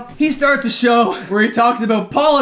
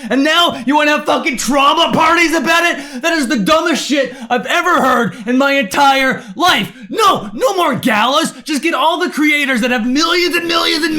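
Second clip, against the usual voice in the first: shouting, higher and heated, laughing in disbelief at first; real furious rant; 6 semitones higher than usual. Real, not acted. Ranting at the organisers about the trauma parties, furious shouting. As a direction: lose it completely, yelling at the organisers. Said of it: "I'm legitimately crashing out right now".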